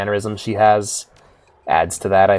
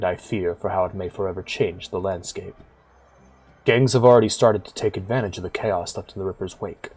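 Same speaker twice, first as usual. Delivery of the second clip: low-key, softer storytelling voice; subdued and grave, sinking quieter at phrase ends; weary big man, sombre; 4 semitones lower, 8 dB quieter than usual. Acted. Cluve confides his fears for the city to Whisper, sombre.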